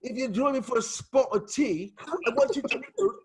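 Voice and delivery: hammed-up English accent, pitch swooping high; silly prim English sales pitch, Mary Poppins style; mock English accent. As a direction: put on an exaggerated English accent. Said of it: "All you people from the U K, your accents gives you".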